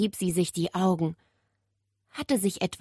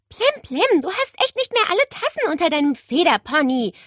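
put-on fast, higher, louder scolding girl; 10 semitones higher, 4 dB louder than usual. Nele scolding the parrot, fast cross voice. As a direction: tell the bird off, quickly. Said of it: "schimpfte Nele".

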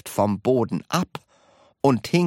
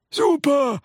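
put-on high, delighted old man's cheer; calling out; 4 dB louder than usual. A narrator's reading. Grandpa enjoying floating, calling out in delight. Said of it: "rief Grandpa Joe".

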